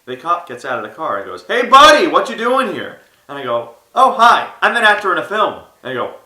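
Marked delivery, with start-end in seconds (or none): 1.4-3.0 loud, high cop's challenge; calling out. Retold or imitated. Cop shouting to a stranger on the street, calling out. 3.9-5.6 high, loud, over-friendly reply; eager greeting. Retold or imitated. Actor explaining himself to a cop, eager and loud.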